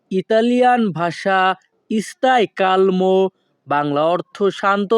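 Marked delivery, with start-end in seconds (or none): 1.9-3.3 stai calmo said slowly, word by word; slow it down so learners can repeat; careful slow demo for students; slow teaching pace. Shown as a demonstration. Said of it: "দুই থেকে তিনবার বলার চেষ্টা করব".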